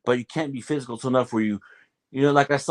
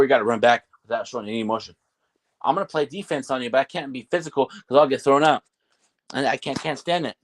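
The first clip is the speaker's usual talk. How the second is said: ordinary talk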